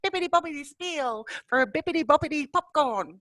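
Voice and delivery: high-pitched princess imitation; Disney princess. Describing the Bali popcorn seller's pitch, mock Disney princess. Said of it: "she speaks like a Disney princess".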